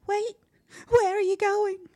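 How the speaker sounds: high, loud shriek after someone; panicked call. A narrator's reading. Brother abandoned by his sister, panicked calling. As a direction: call out desperately as they leave.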